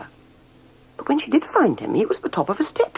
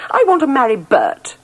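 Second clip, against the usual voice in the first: higher, louder stubborn demand; insistent; 10 semitones higher, 5 dB louder than usual. A narrator's reading. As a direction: dig in your heels. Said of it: "said the princess".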